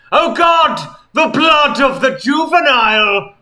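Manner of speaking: hammed-up horror narration peaking at oh God; building frenzied narration. A narrator's reading. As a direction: read the gore with rising manic glee. Gory climax read with mounting fervor.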